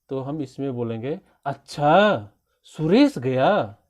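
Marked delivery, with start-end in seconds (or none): 1.4-3.8 loud surprised अच्छा, then a drawn-out Suresh गया; astonished. Shown as a demonstration. Teacher demonstrating surprise that Suresh left, loud astonished tone. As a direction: exclaim अच्छा and गया in disbelief. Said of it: "हमें कुछ आश्चर्य हो रहा होगा".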